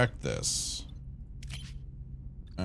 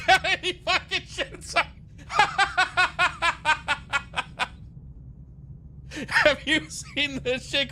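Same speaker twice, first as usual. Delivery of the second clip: talking through real laughter; laughing hard. Real, not acted. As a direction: crack up mid-sentence and keep laughing. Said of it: "'Cause it's fucking hilarious".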